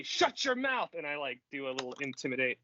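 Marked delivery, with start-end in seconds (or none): 0.0-0.9 mock angry. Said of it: "and I say".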